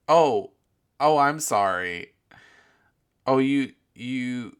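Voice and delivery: exaggerated fake contrition, loud stretched "oh"s; mock apology. Host mimics man caught in the back seat, mock apology.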